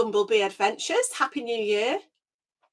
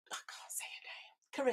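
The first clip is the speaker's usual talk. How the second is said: whispering; 2 semitones higher, 16 dB quieter, slower than usual. Real, not acted. Aside, can't pronounce name.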